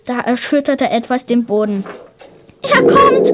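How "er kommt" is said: high, loud frightened cry; trembling fear. A narrator's reading. Girl warns the boy of the monster, trembling with fear. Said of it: "sagte sie mit zittriger Stimme".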